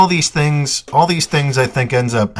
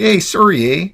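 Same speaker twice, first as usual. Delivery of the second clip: higher, louder mock-Canadian "sorry, eh?"; mock Canadian accent; 7 semitones higher, 3 dB louder than usual. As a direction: imitate a Canadian apologizing. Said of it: "and you'll be like".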